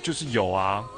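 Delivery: ordinary delivery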